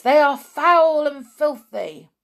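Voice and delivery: high, loud crowd echo; witch chorus. Acted. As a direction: repeat it gleefully as the crowd. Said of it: "chorused the English witches".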